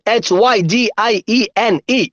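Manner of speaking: letters strung together evenly; speedy spelling. Shown as a demonstration. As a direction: run through the spelling without stopping. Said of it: "ഒന്നുകൂടെ സ്പീഡില്".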